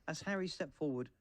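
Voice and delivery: plain reading voice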